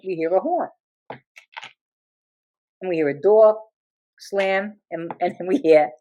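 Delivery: normal talking voice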